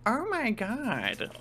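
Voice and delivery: slow, high creature voice, drawn out; a small animal gasping the words in disbelief; prairie dog voice. Acted. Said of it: "the prairie dog looks at him and goes".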